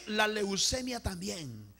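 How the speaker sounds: drawn-out, falling repeat; measured, stretched echo; slow solemn repeat. Real, not acted. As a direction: repeat it slowly, easing off.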